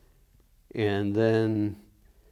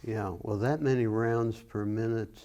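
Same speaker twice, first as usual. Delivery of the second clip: ordinary talk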